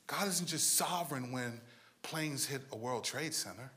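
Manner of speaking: soft, low, drawn-in sermon voice; hushed preaching. Acted. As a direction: lean in and preach softly. Quiet intimate sermon passage.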